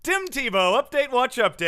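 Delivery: loud, high build-up dropping on the last word; mock segment announcer. Host announcing the Tim Tebow segment, mock announcer. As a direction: belt out the segment title like an announcer.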